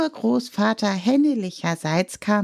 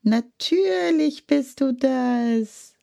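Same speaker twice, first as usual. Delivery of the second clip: higher, slow, dripping sarcasm; sarcastic hen; 10 semitones higher, 4 dB louder, slower than usual. A narrator's reading. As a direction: roll your eyes at a talking candle. (sarkastisch, hohe Hennenstimme)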